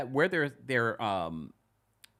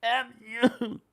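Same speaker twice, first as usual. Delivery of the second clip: mock outburst; 11 semitones higher than usual. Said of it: "I probably would have then said".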